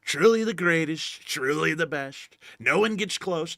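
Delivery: politician impression. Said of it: "let me try it another way".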